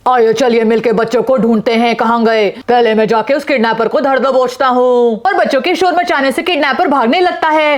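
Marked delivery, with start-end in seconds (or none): 0.1-5.2 lower, urgent man's voice; urgent father. Acted. Yana's father urges a mother off her phone, low and urgent. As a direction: hurry the distracted mother along.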